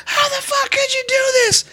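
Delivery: very high-pitched screech without much volume; mock scream. Girlfriend to her man after slamming brakes, mock scream. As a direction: imitate her shrieking from the car. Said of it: "she's screaming".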